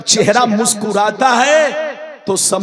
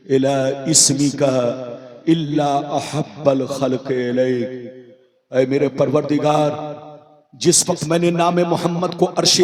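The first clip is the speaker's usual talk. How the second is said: low, soft recitation to God; humble, subdued supplication; hushed prayer; 7 semitones lower, 5 dB quieter than usual. Retold or imitated. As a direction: pray it quietly in a lowered voice. Quotes Adam's prayer to God.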